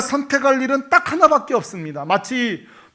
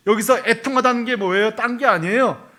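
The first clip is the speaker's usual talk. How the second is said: ordinary talk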